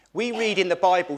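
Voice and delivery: ordinary talk, nothing marked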